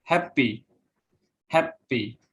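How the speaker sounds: "happy" stressed on HAP, second syllable dropping; first-syllable stress. Shown as a demonstration. Student presenter showing adjective stress, "happy" stressed on HAP. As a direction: hit the HAP of "happy", let the end fall. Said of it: "stress on the first syllable".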